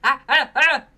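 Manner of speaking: loud, strained "ah" blasts for a mic check; screaming mic test. Shown as a demonstration. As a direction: yell the "ah"s as loud as you can. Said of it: "He screams".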